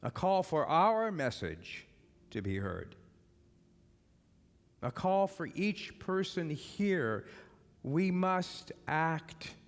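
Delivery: loud, rousing sermon cry, each line punched home; rally the crowd to forgive, full voice; preaching at full force. Acted. Preacher rousing his congregation to act, loud and urgent.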